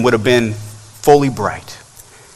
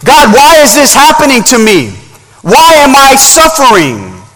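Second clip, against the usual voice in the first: high-pitched, pleading questions to God; preacher voicing a confused, suffering plea; confused crying out; 10 semitones higher, 4 dB louder than usual. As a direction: cry out in acted bewilderment, voice lifted high. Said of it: "There's confusion here".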